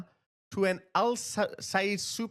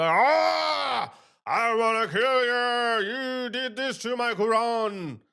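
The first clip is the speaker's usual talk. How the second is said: hammed-up enraged caveman yell, slow; mock caveman rage; 5 semitones higher, 3 dB louder, slower than usual. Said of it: "these people go cavemen".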